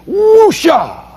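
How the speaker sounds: sharp, very high breathy burst; a whoosh to let the anger go. Shown as a demonstration. Instructor demos his calming "Whoosha!". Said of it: "I did this".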